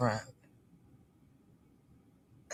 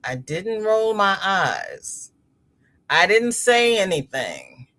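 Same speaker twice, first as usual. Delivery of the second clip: slow, stretched-out denial; drawn-out protest; 2 semitones higher, slower than usual. Retold or imitated. Child denying eye-rolling to mother, slow indignant protest. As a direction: drag out each denial, indignant. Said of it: "I'm like".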